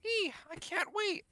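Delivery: high excited squeal. Acted. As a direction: squeal with glee.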